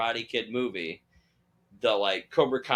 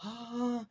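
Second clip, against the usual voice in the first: high, soft intake of breath; reenacted awed gasp; starstruck gasp; 11 semitones higher, 8 dB quieter than usual. Fan remembering meeting a celebrity, awed gasp.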